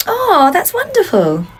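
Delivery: slow, loud, high gush. Acted. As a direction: pour on fake delight. Gushing at parent.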